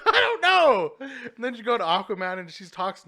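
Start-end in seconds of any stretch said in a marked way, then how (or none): 0.0-0.9 high, loud mock bafflement; hammed-up helpless yell; exasperated outburst. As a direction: throw it out loudly, half laughing. Retelling own baffled reply, hammed up.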